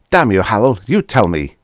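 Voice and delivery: loud angry outburst; angry demand. Acted. Linton curses his friend for refusing to help, loud and angry. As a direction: bark it at him, furious.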